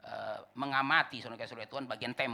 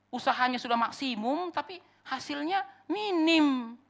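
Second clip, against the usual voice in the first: high, drawn-out emphatic lament; impassioned preaching; 13 semitones higher, 8 dB louder, slower than usual. Acted. Preacher lamenting poor results to congregation, impassioned. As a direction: stretch the last words out high.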